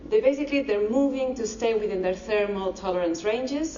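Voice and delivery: ordinary talk, nothing marked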